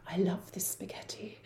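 put-on tiny hushed child's voice; meek whisper. Young son back from the chef's kitchen, meek whisper. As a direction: imitate a cowed little boy, barely audible. Chastened child returns, retold.

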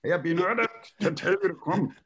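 put-on laughing through a high German-accented plea; German secretary cracking up mid-line; German accent dissolving into laughter. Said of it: "She said".

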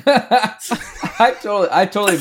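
high-pitched, slowed by laughter; laughing. Real, not acted. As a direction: talk through real laughter. Laughing after the group's joke.

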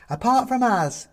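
high and drawn out like Mum; Mum's voice. A narrator's reading. Mum adds from mantelpiece.

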